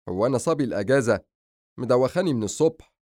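a touch exasperated; mild grumbling. Acted. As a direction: complain about being bossed around. Brother complains about errands.

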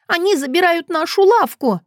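put-on high-pitched girl protesting; angry child. A narrator's reading. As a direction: cry it out as an indignant child. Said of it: "сердито противилась она". (сердитый детский протест)

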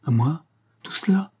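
high, hushed disbelief; amazed exclamation. A narrator's reading. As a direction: gasp the question in amazement. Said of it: "s'écria-t-il". (exclamation incrédule, voix étouffée)

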